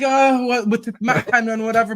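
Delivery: high, loud mock complaint; exaggerated quoting of a moaner; mocking imitation. Mimicking the host's complaint in banter.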